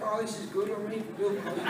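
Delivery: very soft acted aside; hushed delight. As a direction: murmur it quickly to yourself, in character. Starstruck guest's hushed aside, retold.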